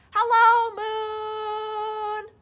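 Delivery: high, loud, sing-song call. Acted. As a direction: sing it out across the water. Bear calling from canoe.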